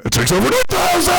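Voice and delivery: very high, fast yelled answer; meme shout. Acted. Answering the owl as the meme villain, yelling very high. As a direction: scream it like the shocked anime villain. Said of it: "Mister Owl, how many licks".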